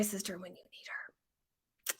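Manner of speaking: slow unvoiced panicked whisper. Real, not acted. To viewers, stuck without the phone number: slow panicked whisper. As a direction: whisper it as if calling for help. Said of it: "I'm panicking".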